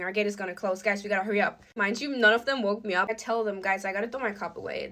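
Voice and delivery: normal talking voice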